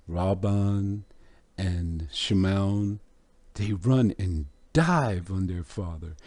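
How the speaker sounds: usual speaking voice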